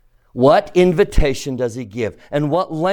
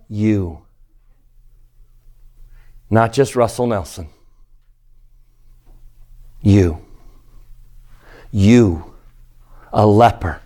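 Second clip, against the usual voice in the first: each you set apart by pauses and pushed louder; emphasizing you; 5 semitones lower, 4 dB louder than usual. Shown as a demonstration. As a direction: hit you hard, with long pauses between. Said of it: "Which word do you think would be emphasized?".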